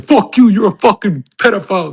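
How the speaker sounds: high, loud put-on outburst; mock furious accusation. Imitating moderators turning on him, loud and high. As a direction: hurl it like a raging moderator.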